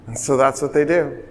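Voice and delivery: ordinary talk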